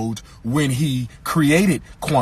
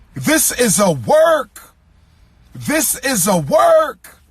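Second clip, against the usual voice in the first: shouted preaching; 5 semitones higher, much slower than usual. Acted. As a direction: hammer it home like a preacher at full force.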